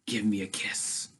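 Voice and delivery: a hint of a drunk sheriff leaning in; lightly voiced drunk sheriff. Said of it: "the sheriff is drunk".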